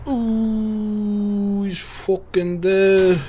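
a high, drawn-out ooh of surprise, then slow; real surprise as the kill lands; surprised ooh. Real, not acted. Player reacting to the sudden kill, surprised.

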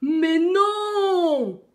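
long, high, loud lion roar; roared protest. A narrator's reading. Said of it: "rugit le lion". (rugissement indigné du lion)

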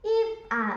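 high humming stopped short; a hummed note cut off by a question; wind's hum breaking off. Acted. Wind stops humming to answer the moon uncle. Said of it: "മൂളിപ്പാട്ടു നിർത്തി".

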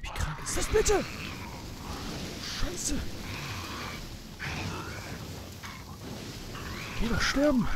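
high terrified screaming; panicked shriek. Real, not acted. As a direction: shriek in real fright. Jump scare in horror game.

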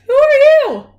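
very high, loud dog voice; piercingly high barked question. A narrator's reading. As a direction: yelp it out like a dog. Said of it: "she barked".

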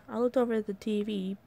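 usual speaking voice

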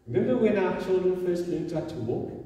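ordinary delivery

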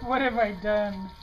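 kitten's inner voice. Retold or imitated. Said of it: "He's like".

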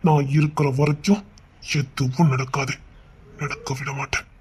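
calm her gently, she's safe with you; father softly comforting his scared daughter; tender reassurance. Acted. Father soothing frightened daughter.

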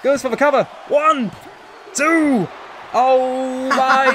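high, suspenseful pin call; breathless excitement. Real, not acted. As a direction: count it out then cry in disbelief. Calling final pin count.

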